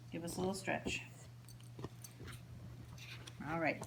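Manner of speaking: usual speaking voice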